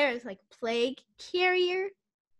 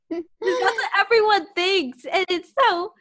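laughing; 5 semitones higher, 3 dB louder than usual. Real, not acted. Laughing while telling.

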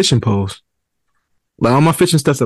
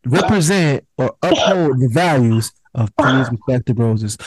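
mock podcast disclaimer, a bit raised and slowed; 3 semitones higher than usual. Host reading a disclaimer to faithful listeners, lightly mock-formal. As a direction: announce it like an official podcast host. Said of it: "my faithful listeners".

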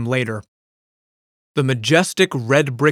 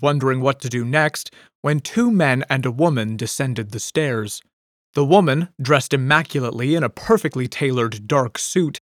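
plain reading voice

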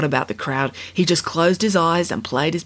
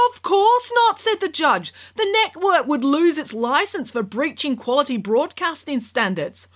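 sarcastic scolding tv judge; 10 semitones higher than usual. A narrator's reading. Judge dismissive.

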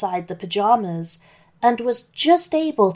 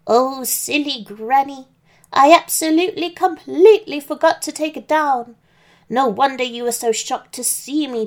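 put-on old granny voice; 4 semitones higher than usual. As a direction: play the wolf faking a sweet granny. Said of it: "my very best old Granny voice".

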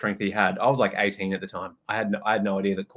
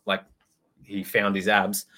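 usual speaking voice

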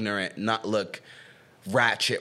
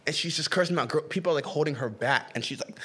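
normal talking voice

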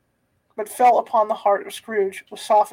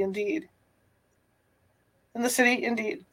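plain reading voice

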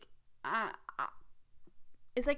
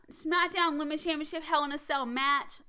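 usual speaking voice

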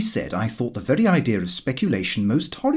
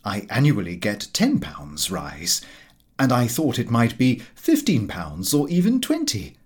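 ordinary delivery, nothing marked

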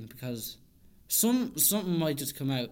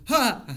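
mock startled yell; 8 semitones higher, 8 dB louder than usual. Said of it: "I would, I like".

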